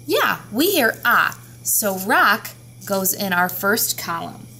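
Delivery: ordinary talk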